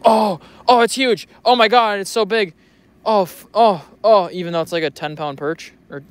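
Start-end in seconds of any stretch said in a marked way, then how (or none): 0.0-4.3 higher, louder hammed-up fish excitement; mock excitement. Mocking fishing YouTubers freaking out over a tiny fish, hammed up. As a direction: overreact like a YouTuber hooking a fish. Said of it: "and you go".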